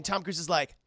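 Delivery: ordinary talk, nothing marked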